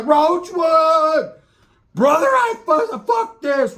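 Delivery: high, loud desperate yell; yelling at partner. Retold or imitated. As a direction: scream for your partner in the brawl. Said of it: "I was like".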